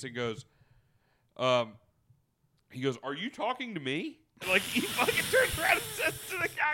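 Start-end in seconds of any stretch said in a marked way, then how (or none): 3.0-4.2 affronted challenge. Retold or imitated. Retells stranger's affronted challenge. 4.5-6.7 high, squealing laugh-talk; cracking up. Real, not acted. Cracks up retelling the confrontation.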